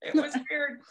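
laughing through it. Real, not acted. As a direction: giggle through weird. Repeats with laugh.

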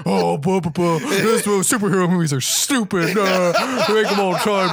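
lightly voiced goofy mimicry with laughs; mock impression of his cohost. Teasing his cohost with a goofy impersonation of him. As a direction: play a pompous tall guy, half laughing. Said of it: "Spot on impersonation".

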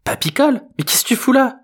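very high, squeaky disbelief; astonished teen girl. A narrator's reading. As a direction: question grandpa in a girl's high voice. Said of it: "s'étonna l'ado".

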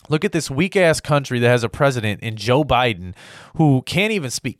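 ordinary talk, nothing marked

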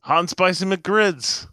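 high, slow, loud teasing announcement; mock announcer. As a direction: announce it slowly like an ad.

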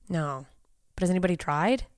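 usual speaking voice

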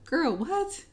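incredulous. Retold or imitated. Toby's mom's face acted out.

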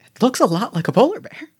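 high, bemused voice; second bystander. Acted. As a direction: answer as an amazed townsperson. Reply in bystander voice.

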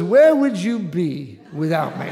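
quoting the brother's joke while cracking up; laughing through it. Retold or imitated. Brother teasing the storyteller, spoken through laughter.

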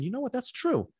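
high, impressed bystander voice coming round to the idea; onlooker won over. Retold or imitated. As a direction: play the convinced onlooker. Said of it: "people that are paying attention".

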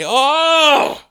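high wounded cry; anguished wail. Acted. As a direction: cry out, hammed-up. Old Dick Lips outburst.

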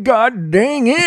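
put-on high, slow old-timer grumble; old guy grumble. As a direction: grumble like a cranky grandpa. Old guy grumbling after slip.

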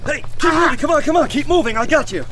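ordinary talk, nothing marked

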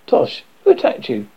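ordinary delivery

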